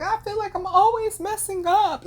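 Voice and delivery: high, mock tearful whine; a hammed-up teary voice; mock whimper. Mocking crying cousin.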